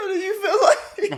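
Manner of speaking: high, slow words squeezed through laughs; helpless laughter. Real, not acted. Host questioning his friend's confession, helpless laughter. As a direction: try to ask it while cracking up.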